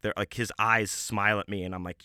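ordinary talk, nothing marked

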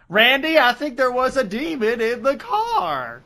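put-on high, slow old-auntie fretting. Troy telling Randy about a demon, as a fussy old aunt. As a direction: fret like a little old aunt. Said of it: "Troy was suddenly Aunt May".

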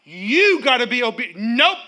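loud commanding nope; firm nope. Pretend. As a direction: order the thought down. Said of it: "Taking every thought captive".

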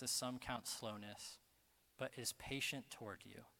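soft, low, solemn delivery with pauses; hushed scripture reading. Real, not acted. Preacher reading Second Peter to the congregation, hushed.

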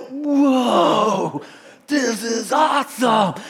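loud, high thrilled cry of wonder; excited whoa. Retold or imitated. Surfer watching dolphins jump, loud thrilled shout. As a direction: shout it out like you just saw dolphins leap.

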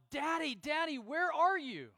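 retold cry across the room; imitated child's scream. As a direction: act out a kid calling out in alarm. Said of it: "they would scream out".

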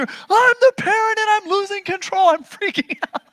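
very high, loud mock freak-out; hammed-up meltdown of a frazzled parent; parent losing control. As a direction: wail it like a parent who has lost it. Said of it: "you're just done with your kids".

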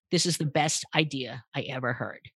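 low, emotionless imitation of the CEO; deadpan. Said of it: "the C E O is dead pan".

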